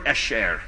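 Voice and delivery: esher, said low: ESH-er, falling; Hebrew word. Shown as a demonstration. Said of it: "a Hebrew word".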